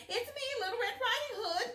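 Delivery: high, loud put-on girl voice; wolf faking a little girl; wolf pretending to be a young girl, high-pitched; little girl's voice. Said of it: "in his best little girl's voice".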